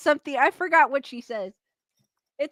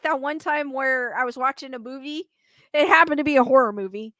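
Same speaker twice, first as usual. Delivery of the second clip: ordinary talk, nothing marked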